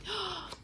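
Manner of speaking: high shocked intake of breath; sharp gasp. Acted. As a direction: gasp in horror. Shock at Aslan's offer.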